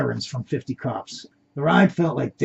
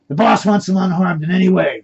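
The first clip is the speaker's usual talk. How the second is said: loud, higher urgent shout; 5 semitones higher, 8 dB louder than usual. A narrator's reading. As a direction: yell it over the struggle. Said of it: "abductor screaming".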